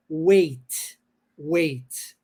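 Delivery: "weight" said like way with a crisp final T; teacher contrasting "weight" with the verb weigh; model pronunciation. Shown as a demonstration. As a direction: land firmly on the T at the end of "weight". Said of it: "um Tzinho a mais no final".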